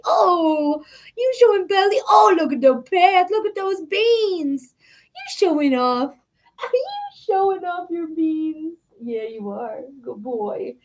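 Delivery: high squealing coo at the cat. Real, not acted. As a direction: gush over the cat's belly. Talking to cat showing belly.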